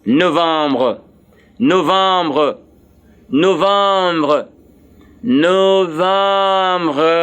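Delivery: November said the French way, nasal "vahm", ending "bruh"; French pronunciation. Shown as a demonstration. Teacher models the next month for learners, November in drawn-out French. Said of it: "The next month is November".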